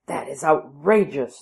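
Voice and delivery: indignant courtiers crying out; outraged cry. A narrator's reading. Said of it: "they cried".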